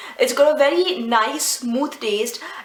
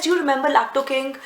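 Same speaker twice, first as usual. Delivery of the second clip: normal talking voice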